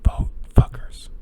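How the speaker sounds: unvoiced, whispered command; whispered urging. Real, not acted. Host urging listeners to vote as the rant closes, whispering. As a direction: whisper it as a parting shot.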